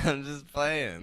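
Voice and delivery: said through a laugh, slow. Real, not acted. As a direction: laugh out the line, drawn out. Repeats it laughing.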